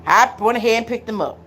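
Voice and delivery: ordinary talk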